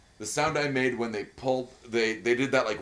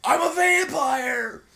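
slow high shouted vampire line; hammed-up shouted movie quote; Nicolas Cage impression; 7 dB louder, much slower than usual. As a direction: shout it slowly like a crazed Cage. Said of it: "Vampire's Kiss".

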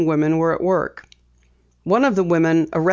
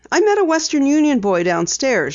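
explaining; 8 semitones higher than usual. A narrator's reading. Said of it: "she explained".

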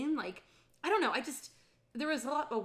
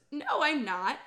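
louder, higher insistence; protesting denial; 4 semitones higher, 8 dB louder than usual. Retold or imitated. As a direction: protest a bit too much. Flustered denial, retold.

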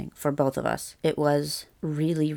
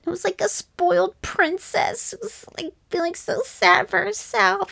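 very high, whiny spoiled-brat voice; spoiled princess. Retold or imitated. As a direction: pout and whine like a self-pitying princess. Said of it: "like a spoiled brat sort of voice".

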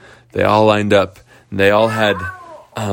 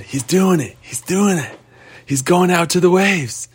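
higher, eager cheering; excited shout; 8 semitones higher than usual. Acted. Patrick and Tommy watching their friend break away, higher and eager. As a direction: cheer him on from the sidelines.

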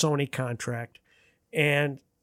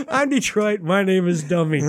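self-mocking dummy voice; 5 semitones higher, 4 dB louder than usual. Mocking himself after a blunder.

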